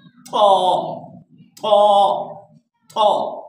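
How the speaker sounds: "तो" as a heavy Arabic emphatic t; full, heavy ta. Shown as a demonstration. Teacher drilling the heavy letter, "तो" fully emphatic. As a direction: give "तो" a full-mouthed emphatic t with rounded vowel. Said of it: "इसको अच्छी तरह से पढ़ना है".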